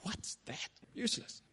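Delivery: unvoiced, deflated whisper; a defeated whisper; whispered dismissal. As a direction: breathe it out in disappointment. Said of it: "it's like".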